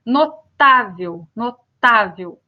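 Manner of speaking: notável said slowly, syllable by syllable, stress on tá; slow teaching demo. Shown as a demonstration. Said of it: "Terminado em L". (notável dito devagar, com ênfase no tá)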